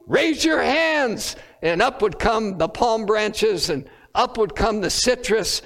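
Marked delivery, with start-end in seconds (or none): 0.1-1.4 raised, slow called-out command; mock festival call. Retelling the priest calling worshipers, lightly shouted call. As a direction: call it out across a crowd, drawn out. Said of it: "And then the call would be".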